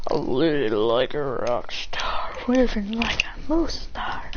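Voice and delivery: slow, unvoiced whispered lyric; whispered sing-song. Real, not acted. Humming to himself mid-story, slow whispered aside. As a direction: breathe the line out slowly as an aside.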